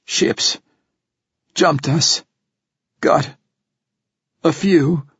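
strained, broken, short gasps of words; a wounded man forcing out each phrase; dying man gasping. A narrator's reading. Dying priest gasps out report.

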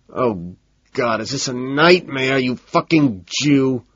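plain reading voice

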